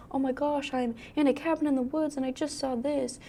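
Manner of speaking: quiet frightened voice; hushed scared friend; hushed scared text. Pretend. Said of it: "You could be like".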